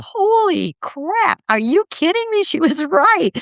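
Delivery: high, slow gasp of disbelief; a newly arrived soul's astonished disbelief; stunned disbelief. Retold or imitated. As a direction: gape in amazement, voice jumping up high. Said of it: "open your eyes and go".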